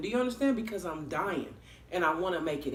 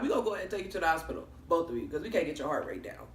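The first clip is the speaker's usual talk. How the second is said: rapid paramedic; 3 semitones lower, 4 dB quieter, much faster than usual. Retold or imitated. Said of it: "they was like".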